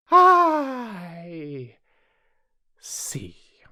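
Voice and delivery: long high "I" falling to a whispered "see"; ominous Queen. A narrator's reading. Queen examining the roses, ominous drawn-out voice. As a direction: start loud and high, drop to a whisper.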